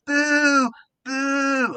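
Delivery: high loud mock boo; crowd booing. Crowd booing the compere, loud mock boo. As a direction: boo the stage. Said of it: "they were going".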